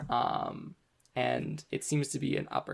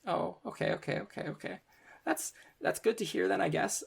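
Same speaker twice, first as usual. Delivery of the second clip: relieved student; 7 semitones higher than usual. Acted. Student relieved after answer.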